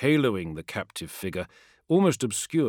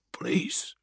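breathless, unvoiced begging; whispered plea; 10 semitones higher, 4 dB quieter than usual. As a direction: whisper it in fear. Terrified man pleading.

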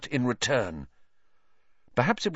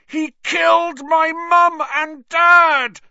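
much higher, louder, slow furious roar; 10 dB louder, slower than usual. A narrator's reading. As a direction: roar it out in rage. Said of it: "Harry roared".

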